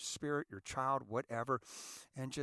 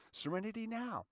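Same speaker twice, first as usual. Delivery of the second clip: light echo; 7 semitones higher than usual. Retold or imitated. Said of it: "Remember the Seinfeld?".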